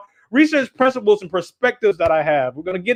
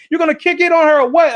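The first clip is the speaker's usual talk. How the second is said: put-on higher, pressing child; pushy friend; 6 semitones higher, 4 dB louder than usual. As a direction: push him to do it. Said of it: "He's like".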